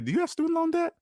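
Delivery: squeaky mock interrogation; mock questioning voice. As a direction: poke at them in a squeaky put-on voice. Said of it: "You always gotta turn to 'em and say".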